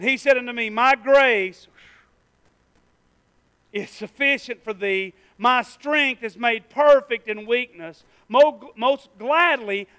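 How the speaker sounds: slow, loud, solemn recitation; scripture reading. Real, not acted. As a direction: read it like holy writ.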